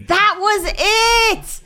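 real thrilled outburst; excited shout. Real, not acted. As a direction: burst out high and long. Excited recognition of the quote.

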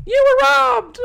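put-on high, whiny child voice; little kid voice. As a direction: talk like an upset little fan. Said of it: "I was a little [name]".

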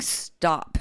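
emphatic mock command; imitated all-caps text. Friend texting back in annoyance, imitated emphatic all-caps. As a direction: read a text as if in capitals. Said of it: "in all capital letters".

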